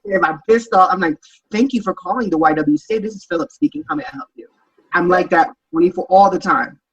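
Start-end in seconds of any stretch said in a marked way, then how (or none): 1.5-4.5 higher, softer sweet phone greeting; lightly voiced receptionist answering the phone. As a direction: put on a polite customer-service voice for the caller.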